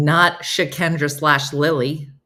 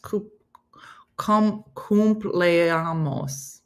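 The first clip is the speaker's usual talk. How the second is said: very slow, halting syllables; laboured slow-motion pronunciation of cumpleaños; sounding it out; 2 semitones higher, much slower than usual. Shown as a demonstration. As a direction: stretch each syllable of cumpleaños, restarting. Sounding out a Spanish word again.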